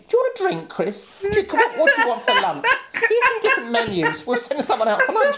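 high, sing-song fawning offer, laughing through it; hammed-up obsequious helper voice; fawning staff. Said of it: "they're constantly sort of checking".

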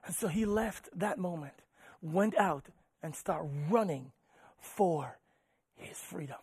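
very soft, with long weighty pauses; hushed, solemn preaching. Real, not acted. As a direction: draw them in quietly, phrase by phrase.

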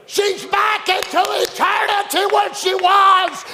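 high, long-drawn shouted proclamation; hollering. Retold or imitated. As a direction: holler each phrase out like a herald. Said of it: "Them hollering up".

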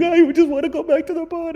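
very high, wailing blubber; hammed-up sobbing plea; mock crying whine. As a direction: imitate a grown man bawling. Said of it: "he's literally crying".

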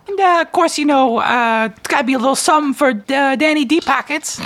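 very high, squeaky hustler voice. Acted. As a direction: play a slick, grinning money changer. Money changer asks for his cut.

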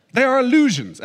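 high, loud declaration of a pompous professor; mock grand pronouncement as Freud; imitating Freud. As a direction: announce it like a self-sure lecturer. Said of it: "he's German".

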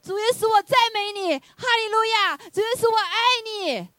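high, loud prayer cry with a catch of tears; reenacting the sister's praise; fervent praise. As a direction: pour out praise to Jesus. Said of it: "就赞美啊".

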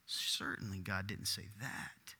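hushed slow tempter voice; sly serpent. Retold or imitated. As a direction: murmur it like the tempter.

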